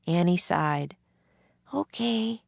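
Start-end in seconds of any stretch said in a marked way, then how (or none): 1.7-2.4 high, reluctant little agreement; sad. A narrator's reading. Annie gives in to Jack, sadly. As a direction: give in with mild sadness. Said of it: "she said sadly".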